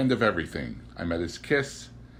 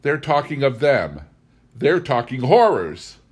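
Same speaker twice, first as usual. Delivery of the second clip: louder insistence, falling hard on horrors; vehement declaration; 4 semitones higher, 8 dB louder than usual. A narrator's reading. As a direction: declare it like a dread certainty. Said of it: "I declared".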